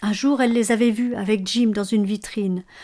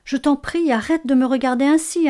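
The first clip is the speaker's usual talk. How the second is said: higher, louder anxious plea; a young wife pleading with her husband, voice raised and anxious; 6 semitones higher, 6 dB louder than usual. A narrator's reading. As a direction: beg him to stop staring. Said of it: "implora Delia".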